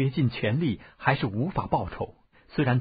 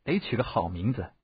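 low, musing elder; pondering; 4 semitones lower than usual. A narrator's reading. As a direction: think it over aloud in a lower voice. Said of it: "谢逊沉吟道". (低声沉吟)

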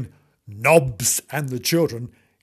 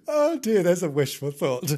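ordinary delivery, nothing marked

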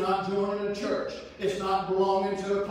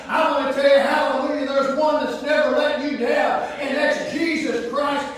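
loud, higher fervent preaching; full-force sermon shout; 5 semitones higher, 7 dB louder than usual. Acted. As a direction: shout it out to the congregation.

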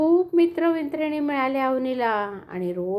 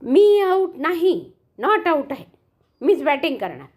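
angry shout; 3 semitones higher, 5 dB louder than usual. Acted. Boy yelling at Avani over the out, angry. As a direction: shout him down. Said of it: "जोरजोरात म्हणाला".

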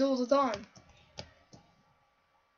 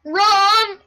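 urgent loud shout; yelling; 3 semitones higher, 6 dB louder than usual. Real, not acted. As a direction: yell at them to run. Fleeing mobs in game.

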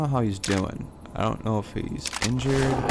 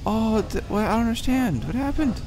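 shocked surprise; 8 semitones higher than usual. Real, not acted. Shocked at game event.

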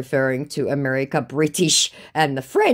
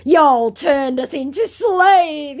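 high, loud, very slow accusation; hammed-up grievance, stretching the words; mock outraged declaration; 12 semitones higher, 8 dB louder, much slower than usual. Scammer declaring his grievance to her, mock outraged. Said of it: "he declares".

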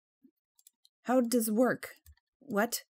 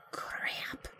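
whispered curse; 7 dB quieter than usual. Real, not acted.

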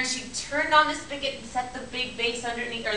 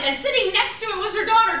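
usual speaking voice

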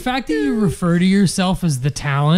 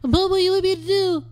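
very high mock falsetto; squeaky-high, put-on voice teasing the co-host; mocking impression of co-host; 13 semitones higher than usual. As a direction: imitate him in a silly high voice. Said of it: "drive home fast to Hacienda and go".

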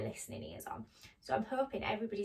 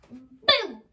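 loud, sudden playful burst; startling boo; 13 dB louder than usual. Acted. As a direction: jump out to scare the cat. Mouse startles the cat.

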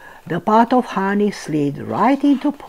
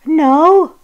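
squeaky voice; 9 semitones higher, 5 dB louder than usual. A narrator's reading. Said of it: "said Piglet".

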